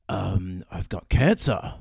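low, slow deadpan improv patient; mock-solemn confession; Liam Neeson. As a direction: deliver it gravely, dropping low. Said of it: "He goes".

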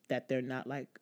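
usual speaking voice

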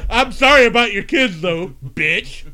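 loud high sarcastic sneer; mocking loud jab. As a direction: throw it out loud with scorn. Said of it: "And I wrote back".

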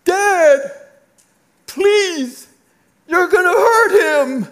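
high-pitched, loud pleading cry; shrieked, desperate acted plea; children screaming. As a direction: imitate frightened kids screaming down at their dad. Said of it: "they're screaming down to me".

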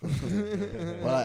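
laughing. Real, not acted. Laughs at friend's joke.

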